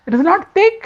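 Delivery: high, incredulous king's question; the king can't believe the buttermilk isn't thick; surprised king. Acted. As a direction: pitch it way up in disbelief. King disbelieves the thin buttermilk.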